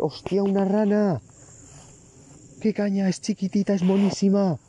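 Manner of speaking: high, thrilled squeal over a tiny frog; real excited surprise at a frog; excited delight. Real, not acted. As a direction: gush over something cute.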